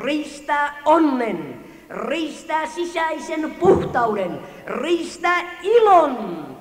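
full-voiced revival preacher, slow and building; fervent preaching. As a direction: preach it over the hall with rising fire.

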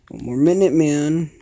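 loud urgent shout. Real, not acted. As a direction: yell it to push on. Tense countdown in game.

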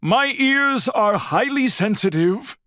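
high, exasperated protest; crying out. A narrator's reading. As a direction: cry out at the end of your patience. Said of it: "cried Bear".

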